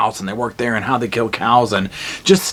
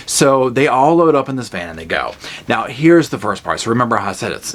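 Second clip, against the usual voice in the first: usual speaking voice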